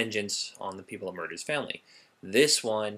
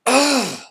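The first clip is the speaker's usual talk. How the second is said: loud, high "ugh" of real frustration; high, fed-up outburst; exasperated groan; 12 dB louder than usual. Real, not acted. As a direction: let out a loud, peeved growl of exasperation. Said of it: "I'm a little peeved at this".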